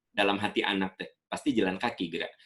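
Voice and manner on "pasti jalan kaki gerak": ordinary talk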